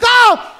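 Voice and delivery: loud high cry of shock; loud gasp. Retold or imitated. Under the freezing shower, a loud high yelp. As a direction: yelp like icy water just hit.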